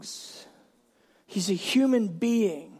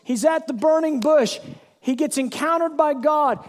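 normal talking voice

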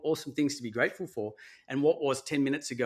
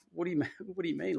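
high, puzzled what do you mean; mock baffled disbelief, pitched up; confused, laughing disbelief; incredulous; 6 semitones higher, 3 dB quieter, faster than usual. Incredulous reply to condolence messages.

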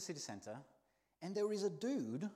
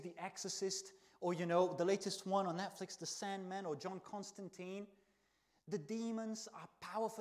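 normal talking voice

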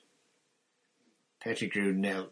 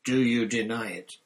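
louder, challenging question; angry demand; 3 semitones higher, 7 dB louder than usual. A narrator's reading. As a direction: demand an answer. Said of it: "who was shaking too".

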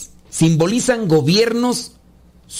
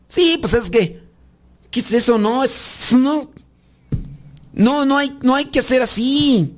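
higher pitched exasperated scolding; worked up; 7 semitones higher than usual. Real, not acted. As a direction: protest in rising irritation. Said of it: "Ya me enchilé".

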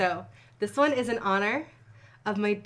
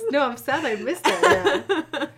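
laughing; 6 semitones higher, 9 dB louder than usual. Real, not acted. Laughs over the Facebook story.

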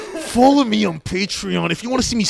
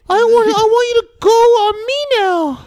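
high squeaky victim voice; 5 dB louder than usual. Retold or imitated. Friend acting out a Me Too victim, squeaky high voice. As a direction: voice jumps way up, squeaky and a bit louder.

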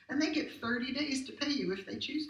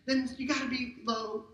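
higher, louder and fast; preaching at full force; 3 semitones higher, 5 dB louder than usual. Real, not acted. Pastor preaching to the congregation, loud and fast. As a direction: drive it home to the crowd.

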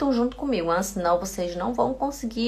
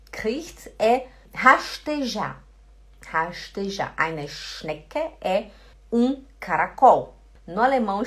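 normal talking voice

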